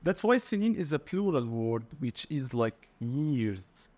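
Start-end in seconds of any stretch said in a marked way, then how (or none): none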